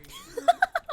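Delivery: sudden squealing laugh, high and loud. Real, not acted. Laughing at her partner's wild guess, high squealing laugh. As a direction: let a high giggle burst out.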